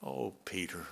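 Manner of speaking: soft, tender sigh of a name; affectionate. Retold or imitated. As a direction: say his name with fond sorrow. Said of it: "a look of affection".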